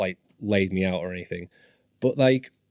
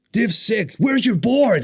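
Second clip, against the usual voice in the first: high, loud retold demand; mock demanding shout; 13 semitones higher, 6 dB louder, slower than usual. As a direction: imitate a brash skater shouting at strangers. Phelps demanding their boards, retold.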